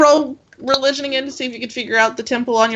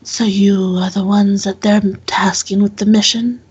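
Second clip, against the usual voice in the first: lightly voiced stern interrogator, measured; a hint of a dire, probing temple guard; stern temple questioner. Temple woman questioning the strangers about their mission, lightly stern.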